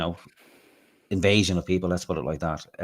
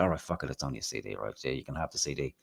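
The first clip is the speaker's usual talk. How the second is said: resigned giving in; 5 semitones lower, 9 dB quieter than usual. Retold or imitated. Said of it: "So then I said".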